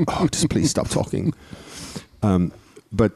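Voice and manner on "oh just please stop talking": fed up. Retold or imitated. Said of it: "I just like".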